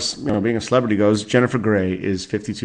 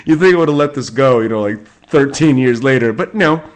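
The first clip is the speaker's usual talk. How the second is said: louder and fast, still amused; laughing self-mockery; 3 semitones higher, 5 dB louder than usual. Real, not acted. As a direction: poke fun at your own grudge. Laughing at own grudge.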